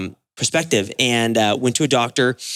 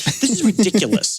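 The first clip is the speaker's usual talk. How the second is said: high, fed-up disbelief; recalling leaving the doctor, high and fed up; exasperated scoff; 9 semitones higher than usual. Retold or imitated. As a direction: toss it off in exasperation. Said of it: "I was so mad".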